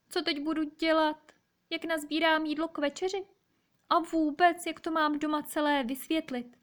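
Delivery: high-pitched worried hedgehog voice; high, anxious storybook hedgehog; worried little hedgehog. Acted. Distressed hedgehog wonders what to do.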